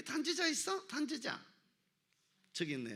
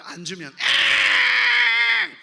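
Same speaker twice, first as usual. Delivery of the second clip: put-on slow, loud childish whine; husband mimicked as whining baby; whiny child; 7 semitones higher, 13 dB louder, much slower than usual. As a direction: wail like a spoiled kid. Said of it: "애가 돼가지고".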